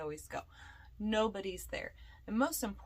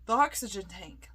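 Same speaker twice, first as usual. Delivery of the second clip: alarmed exclamation; 5 dB louder than usual. Pretend. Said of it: "I would just be like".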